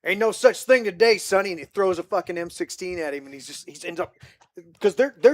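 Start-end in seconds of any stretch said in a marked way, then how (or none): 0.0-1.5 louder gruff sergeant bark; lightly voiced old soldier, dismissive; mock drill-sergeant retort; tough sergeant. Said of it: "He goes".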